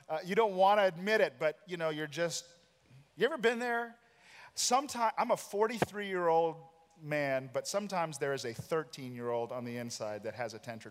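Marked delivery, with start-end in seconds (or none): 3.2-7.5 high, emphatic aside to the congregation; animated appeal. Real, not acted. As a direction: voice jumps high and louder, pleading with the room.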